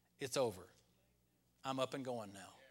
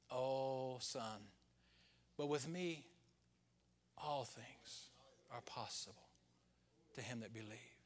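gentle whisper; 2 semitones lower, 5 dB quieter, slower than usual. Retold or imitated. God answering a discouraged son, imitated hushed whisper. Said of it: "And then I hear him whisper".

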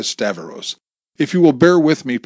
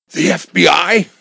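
high, louder shocked gasp; 8 semitones higher, 7 dB louder than usual. A narrator's reading. Wife stunned to meet an FBI agent, gasping. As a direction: catch your breath at the news. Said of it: "gasped Amy".

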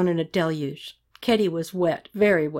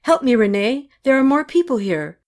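raised, higher urgent call; acted plea, pitched up; calling for help; 5 semitones higher, 4 dB louder than usual. A narrator's reading. Yelling to Renee for help.

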